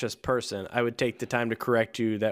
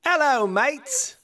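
slow, high British dockhand greeting; cheery British dock manager hailing the boys; British accent; 13 semitones higher, 3 dB louder, much slower than usual. Acted. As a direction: call it out in a hammy British accent. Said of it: "No, it's British".

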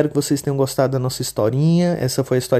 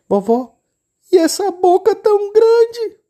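put-on much higher, childlike little girl voice; 7 dB louder, slower than usual. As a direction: play the innocent little girl. Menina pergunta. (menininha de voz fininha e aguda)

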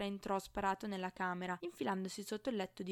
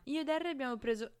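fast, higher, gloating announcement; smug chirping; 6 semitones higher, 5 dB louder, faster than usual. A narrator's reading. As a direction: chirp it quickly with malicious glee. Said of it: "cinguettò Zabini da perfetto bastardo". (cinguettio perfido)

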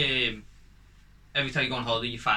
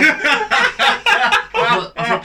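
laughing hard, high and loud through the words; can barely get the words out for laughing; cracking up mid-story; 11 dB louder, much slower than usual. Real, not acted.

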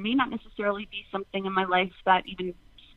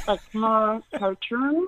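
ordinary talk, nothing marked

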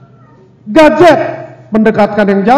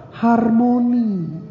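drawn out; 6 dB quieter, much slower than usual. Real, not acted. Draws out the answer word.

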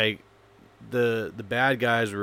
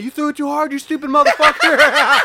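loud, high-pitched shouted rebuke; furious yelling, imitated; 11 dB louder than usual. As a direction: scream at the idiot who overthrew it. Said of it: "they're fucking yelling at me".

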